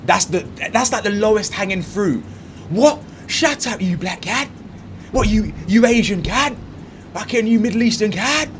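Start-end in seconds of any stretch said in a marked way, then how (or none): 2.7-8.5 mocking imitation of a hothead, higher-pitched; hammed-up flustered rant, pitched up; mock heated outburst. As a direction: act out someone losing composure, mockingly. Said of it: "as soon as your emotions are heated".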